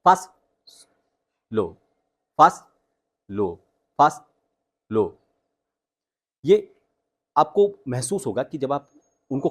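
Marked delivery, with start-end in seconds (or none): 0.1-5.2 fast and low mix. Shown as a demonstration. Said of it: "mix करके बोलते हैं".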